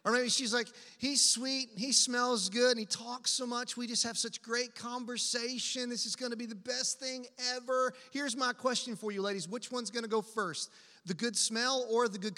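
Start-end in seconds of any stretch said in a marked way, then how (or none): 1.0-7.9 higher-pitched lovestruck chatter; gushing smitten woman. Acted. Preacher mimics a woman listing his virtues, gushing. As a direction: imitate a woman thrilled with her new man.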